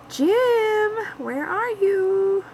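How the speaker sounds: high, stretched, whiny call for Jim, slow; plaintive high call. Pretend. Calling for Jim in the chat, plaintive high whine. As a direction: call out like a lost child, drawn out.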